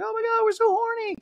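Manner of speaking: very high imitated crowd squeal; high-pitched parody shriek; mock audience scream. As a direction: squeal like overexcited fans. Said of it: "The entire audience screams".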